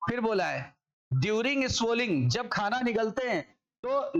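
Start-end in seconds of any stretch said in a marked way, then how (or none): 1.1-2.3 slow dictation read. Shown as a demonstration. Teacher slowly reads textbook words.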